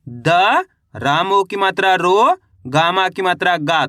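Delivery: द alone, रो stretched upward, गा falling; letter-by-letter spelling. Shown as a demonstration. Teacher has pupils copy the method, each letter apart, रो rising and गा falling. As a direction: sound out each piece apart, lifting रो, closing on गा. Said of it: "एक एक अक्षर का उच्चारण करके".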